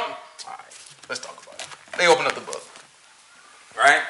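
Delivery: usual speaking voice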